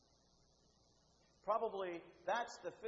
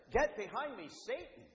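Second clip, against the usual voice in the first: loud, higher-pitched stern command; forceful rebuke; 6 semitones higher, 7 dB louder than usual. Retold or imitated. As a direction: rebuke hard, loud and firm. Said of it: "is much more forceful".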